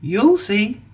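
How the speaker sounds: a little engine teasing his friend with a grin; grinning. A narrator's reading. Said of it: "grinned Thomas".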